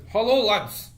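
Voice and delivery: a half-hearted go at a Scottish listener's greeting; Scottish accent. Retold or imitated. Reading out a Scottish listener's email in a light Scottish accent. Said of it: "No, that's not the accent".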